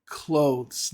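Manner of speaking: clothes as one syllable, "klohdhz"; clothes with TH running straight into Z; correct clothes. Shown as a demonstration. Teacher introducing the word clothes, said as one syllable. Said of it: "the third one we're gonna talk about".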